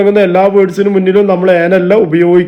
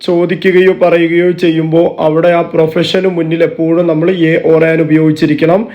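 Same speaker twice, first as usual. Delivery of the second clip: ordinary talk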